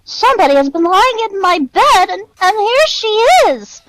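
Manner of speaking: high little bear cub voice; small wee Baby Bear. A narrator's reading. Baby Bear finds Goldilocks in his bed, small high voice. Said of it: "in his small, wee voice".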